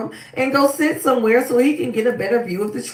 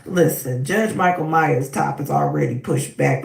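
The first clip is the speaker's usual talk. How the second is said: ordinary talk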